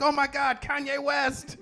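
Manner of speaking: high gushing celebrity spotting; excited fan. Retold or imitated. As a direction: squeal like a star-struck fan. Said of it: "They were like".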